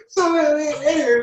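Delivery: breaks into high laughter; laughing. Real, not acted. Laughing to interviewer about the dripping hamburger.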